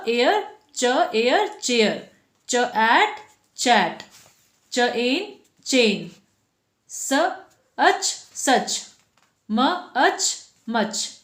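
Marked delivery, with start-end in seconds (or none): 0.8-6.1 च एयर चेयर said slowly, each sound apart, then joined; slow sound blending. Shown as a demonstration. Teacher blending ch words for pupils, slow. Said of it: "तो sound आ रहा है च।". 7.8-11.2 अच सच and अच मच split and joined very slowly; slow sound blending. Shown as a demonstration. Teacher building ch words sound by sound, slow. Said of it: "तो sound आ रहा है च।".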